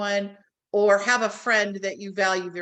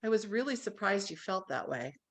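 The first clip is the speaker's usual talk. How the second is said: much softer, gentle and calm; 2 semitones lower, 9 dB quieter than usual. Shown as a demonstration. Demoing the calm tone with a partner, softly. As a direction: say it gently, defusing the fight. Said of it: "does that sound different".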